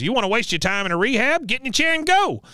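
fast, high-pitched bossy impression; Cotton. Recapper as Cotton ordering her into the chair, high-pitched bossing. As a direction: rush it, climbing high into an order. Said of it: "he turns back in and goes".

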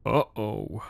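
soft worried mutter. Acted. Buddy mutters in dread as the machine reacts. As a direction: mutter it under your breath. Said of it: "my buddy muttered".

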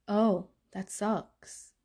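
very slow, drowsy sympathy; sleepy sister. Retold or imitated. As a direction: stretch it out half-asleep. Sister's flat reply.

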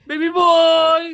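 put-on high, loud sing-song baby boy; mock doting parent calling a little son. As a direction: call out like a gushing parent. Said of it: "Kahit sa lalaki".

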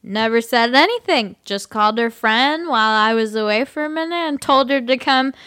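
put-on sloppy, slurring drunk man. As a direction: whine like a drunk guy. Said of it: "I'll just do my drunk voice".

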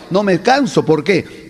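ordinary talk, nothing marked

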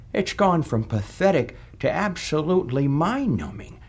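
usual speaking voice

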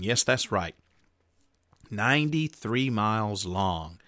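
ordinary delivery

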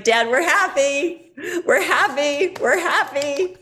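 high, drawn-out, near-tearful insistence; forced happiness. Retold or imitated. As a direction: act out cheer on the edge of crying. Forcing happiness while dad tears up.

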